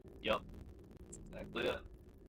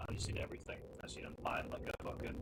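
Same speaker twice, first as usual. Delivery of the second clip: whispering; 10 dB quieter, faster than usual. Real, not acted. Muttering to himself while mining.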